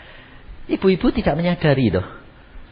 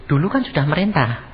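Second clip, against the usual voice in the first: usual speaking voice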